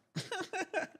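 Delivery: quick high falling laugh; a short delighted laugh. Real, not acted. Streamer laughing at his tournament hole in one.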